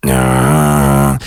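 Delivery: deep held bass through mouth and nose; nasal throat bass. Shown as a demonstration. Coach showing mouth and nose together, nasal throat bass. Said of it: "use your mouth and your nose".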